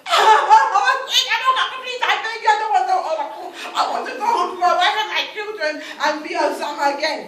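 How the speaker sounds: high, loud desperate pleading; frantic begging. Acted. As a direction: plead to be freed. Oba begs to go home.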